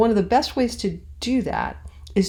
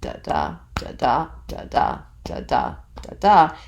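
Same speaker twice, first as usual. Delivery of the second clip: "da DA" five times, like a steady heartbeat; heartbeat rhythm; 4 semitones lower than usual. Shown as a demonstration. Teacher sounds the heartbeat of the meter, heartbeat rhythm. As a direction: pulse "da DA" evenly, weight on each second DA. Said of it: "when the heartbeat".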